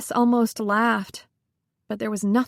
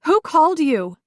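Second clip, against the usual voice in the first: raised, higher and louder, near a shout; almost shouting; 6 semitones higher, 5 dB louder than usual. A narrator's reading. Grieving widow demanding answers from her friend, almost shouting. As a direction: demand it, voice climbing toward a shout. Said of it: "was aware that she was almost shouting".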